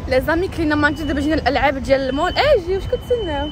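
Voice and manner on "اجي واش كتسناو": high, slow, stretched call. Real, not acted. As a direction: call them over, drawing it out. Calls others over to play. (عياط ممدود بصوت عالي)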